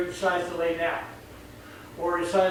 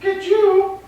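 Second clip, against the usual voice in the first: building higher and louder, indignant; a spoiled dog growing furious for treats; treat-spoiled dog complaining, rising to much higher pitch; demanding dog; 4 semitones higher, 3 dB louder than usual. Retold or imitated.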